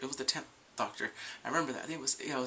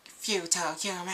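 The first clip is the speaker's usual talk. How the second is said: a hint of a robotic Dalek, higher; clipped alien menace, lightly done; 6 semitones higher, 4 dB louder than usual. Vlogger attempting a Dalek line, light robotic menace. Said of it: "I can't do it".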